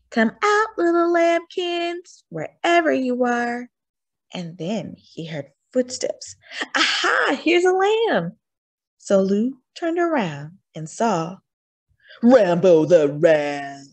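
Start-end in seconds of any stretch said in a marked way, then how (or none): 0.1-3.7 much higher, sing-song calling; coaxing wolf. A narrator's reading. Wolf calling the hidden lambs, high and coaxing. 6.7-8.4 much higher, louder triumph; gleeful wolf. A narrator's reading. Wolf hearing footsteps, high and gleeful. 12.2-13.9 slow, loud and weighty; dramatic booming announcement. A narrator's reading. Narrator announcing the ram to listening children, slow and booming.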